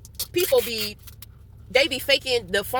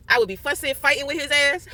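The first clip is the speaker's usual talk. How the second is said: ordinary delivery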